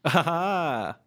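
slow, drawn-out imitation "ah, ha, ha"; soundbite impression. Repeating the favourite soundbite for the co-host, imitated laugh. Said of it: "that's a decent impression of it".

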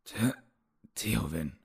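halting, stumbling over the name; stammering. A narrator's reading. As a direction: stammer it out weakly. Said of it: "stammelte der Verletzte".

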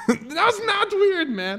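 very high, drawn-out protest with laughter; incredulous retort. Retold or imitated. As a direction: laugh and squeal it in mock outrage. Retold retort to Jack, laughing.

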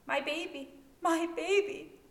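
high, loud wailing cry; panicked mother. A narrator's reading. Collapsing mother wails for her baby, high and loud. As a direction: cry out for your unborn child.

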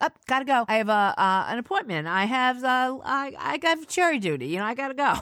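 inventing reasons to flee dinner; flustered making excuses. Retold or imitated.

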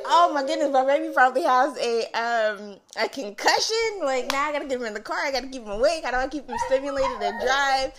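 loud, high, frantic mock worry; retold racing panic. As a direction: spill out panicked thoughts in a rush. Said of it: "my mind was just going a million miles per hour".

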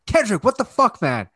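high, loud exasperated cry; retold scolding. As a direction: scold the kid in exasperation, pitch jumping up. Scolding his son in a retold scene.

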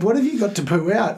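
high, disbelieving question; incredulous. Retold or imitated. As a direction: ask it in total disbelief. Incredulous at son's second poo.

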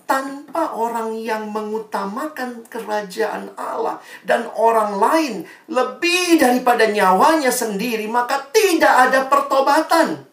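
high, loud building preaching; preaching at full force. Real, not acted. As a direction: rise to a fiery climax. Climax of sermon. (khotbah berapi-api semakin keras)

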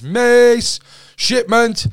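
high, loud call down the wing; officer calling out. Retold or imitated. Guard announcing a transfer on the wing, loud call. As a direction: bark it out like a guard's roll call.

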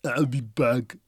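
mock Austrian accent impression; thick Austrian-style movie catchphrase; Terminator. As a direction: do a hammed-up Austrian action-hero accent. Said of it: "Like the Terminator".

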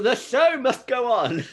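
high, loud, laughing through the words; mock theatrical line, cracking up; a grand show-business cliché half lost in laughter; laughing mock drama. Mock-dramatic quote while laughing.

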